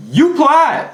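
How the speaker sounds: You applied in a high Southern drawl; southern twang. Shown as a demonstration. Coach scolding viewers in a Southern twang. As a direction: lay on a Southern twang, pitch way up. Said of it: "That was my southern twang right there".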